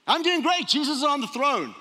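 high, loud, over-cheerful front; faking it upbeat. Acted. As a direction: put on a bright "all fine" act for your wife. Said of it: "I can't fake my wife".

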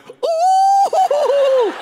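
high, loud mocking ooh; shrill gleeful whoop at dad's comeuppance; teen's gloating ooh. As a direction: crow like a delighted teenager. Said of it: "I remember Alan going".

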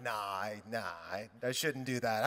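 low, soft, hesitant demurral; reluctant refusal. Retold or imitated. Pastor declining his friend's Ferrari offer, reluctant. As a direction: back out quietly, in a low voice.

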